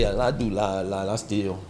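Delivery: low, quiet confession of a thief; a lightly voiced confessing thief; mock confession. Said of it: "Nobody gonna tell you".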